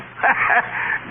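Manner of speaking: breathy gleeful chuckle; gleeful laugh. Acted. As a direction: laugh with delight. Scrooge laughs happily.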